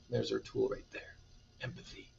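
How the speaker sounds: whispered, very soft aside; hushed aside. Real, not acted. Reader points out the tool to listeners, whispered aside. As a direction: point it out under your breath.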